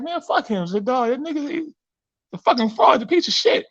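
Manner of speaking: high, scornful comeback; mock retort. Retorting to the Jamaican guy.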